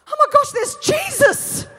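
high, loud, slow excited shout; an inappropriately loud fan-girl cry; starstruck yell. Retold or imitated. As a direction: yell it across the restaurant, starstruck. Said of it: "I yell across the restaurant".

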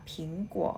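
"ผิงกัว" said as Mandarin ping-guo with a rising end; "ผิงกัว" in Chinese tones; Mandarin reading. Shown as a demonstration. Teacher giving the Chinese name for Apple, Mandarin tones. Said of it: "คนจีนอ่านว่า".

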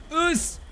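short, very high yelp; quick high-pitched attack noise; battle yelp. Real, not acted. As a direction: yelp it out high as you strike.

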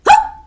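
very high loud gasp; startled gasp. A narrator's reading. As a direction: jolt the listeners. Jump scare gasp.

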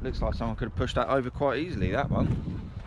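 normal talking voice

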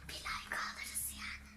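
hushed unvoiced whisper. Real, not acted. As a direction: whisper so he won't hear. Narrating a prank beside brother.

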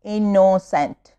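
innocent slowly split into its syllables. Shown as a demonstration. Teacher showing pupils three syllables, innocent slowly broken up. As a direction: stretch out each syllable of innocent. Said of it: "three syllable".

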